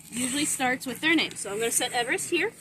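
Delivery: normal talking voice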